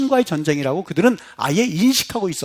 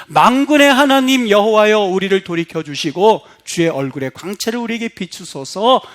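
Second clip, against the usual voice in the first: prayerful psalm reading; 4 dB louder than usual. Real, not acted.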